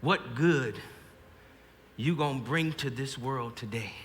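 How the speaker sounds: soft, low remembered mother's voice; Ma's voice. Acted. Soldier at the line hears Ma's voice, softly recalled mother. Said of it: "I hear Ma's voice".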